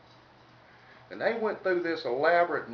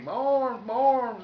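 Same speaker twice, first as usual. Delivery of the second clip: higher pained holler, like a stuck pig; 9 semitones higher, 3 dB louder, slower than usual. Retold or imitated. Storyteller's skier yelps after the fall, mock pained holler. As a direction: yell out for your arms, hammed up. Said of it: "hollering like a stuck pig".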